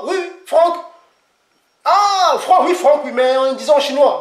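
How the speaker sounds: high, bright "oui, Franck" in sudden recognition; excited host. Retold or imitated. Franck acts out a host recognising his name, high and excited. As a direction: sing out the name, pleased and high. Acted exchange about name in China.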